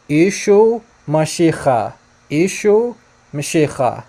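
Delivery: "Ishu Mashiach" very slow, as ee-shoo muh-shee-kha. Shown as a demonstration. Teacher repeating what he says for viewers, slowed down. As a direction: spell out "Ishu Mashiach" sound by sound, drawn out. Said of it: "Let's slow it down".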